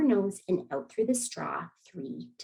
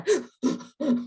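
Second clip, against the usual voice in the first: quick gasping breaths; mock panicky breaths; 9 semitones higher than usual. Shown as a demonstration. Sometimes that turns into the.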